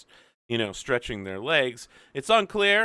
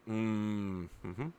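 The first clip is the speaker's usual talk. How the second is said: terse murmur; 3 semitones lower, 11 dB quieter, slower than usual. Acted. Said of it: "And he's like".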